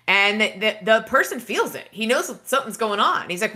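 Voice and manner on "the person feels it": ordinary delivery, nothing marked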